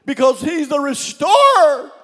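high, loud restorer; proclaiming shout; shouted proclamation. Real, not acted. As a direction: cry it out to the church. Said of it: "repeated preaching line".

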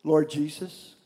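hushed prayer invitation; gentle altar call. Real, not acted. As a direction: guide them quietly into prayer.

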